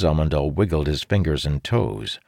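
ordinary delivery, nothing marked

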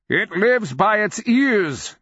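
high, drawn-out urgent shout; old wizard's cry. A narrator's reading. Old wizard calls to allies amid thunders, crying out. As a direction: cry it out slowly over a din. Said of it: "the old wizard cried between thunders".

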